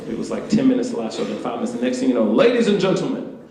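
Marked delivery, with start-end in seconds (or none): 2.2-3.4 high, loud announcer voice; show announcer. Retold or imitated. Announcer opens the fashion awards show, grand and loud.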